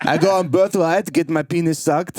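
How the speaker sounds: put-on high, Borat-like Israeli accent; bad Israeli accent. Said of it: "It's like Borat".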